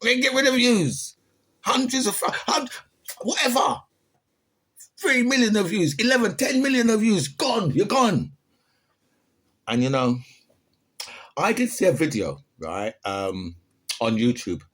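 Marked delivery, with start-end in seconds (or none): 0.0-8.3 mock politician. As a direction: parody a blustering politician. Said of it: "a man who said".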